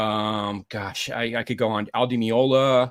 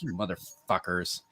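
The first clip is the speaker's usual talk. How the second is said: muttered curse; 5 semitones lower, 9 dB quieter, slower than usual. Retold or imitated. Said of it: "I'm like".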